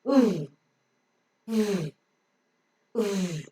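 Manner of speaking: V as a held voiced buzz, written oo; phonic V. Shown as a demonstration. Teacher giving the letter V's sound, buzzed V. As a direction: hold oo as a V, lip close to upper teeth. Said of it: "V has got a phonic sound".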